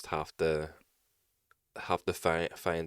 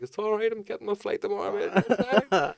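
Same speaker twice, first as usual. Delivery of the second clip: cheeky high reply; 14 semitones higher, 3 dB louder than usual. Retold or imitated.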